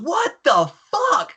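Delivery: very high, drawn-out mock outrage; incredulous high-pitched outburst, acted; mad officer. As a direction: imitate a furious officer, stretching each word. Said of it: "And he's mad".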